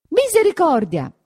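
high, loud cry of alarm; a woman shrieking as she runs; piercing loud misericordia; scream. A narrator's reading. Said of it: "grida anche Agnese".

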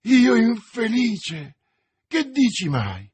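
loud, drawn-out, high dismay; dismayed exclamation. A narrator's reading. D'Artagnan, accused of poisoning, protests, dismayed exclamation. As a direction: cry out, appalled at the accusation. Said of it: "esclamò D'Artagnan".

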